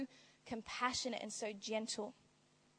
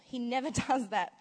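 ordinary delivery

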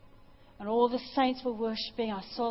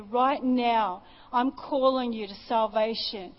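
louder, urgent call to salvation; altar call preaching; 2 semitones higher, 7 dB louder than usual. Acted. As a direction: press the crowd to come forward.

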